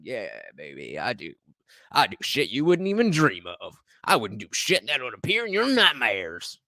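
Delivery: low mock Southern come-on; southern accent. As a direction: put on a sleazy Southern US accent. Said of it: "I don't know why I give him a, uh, uh, southern accent".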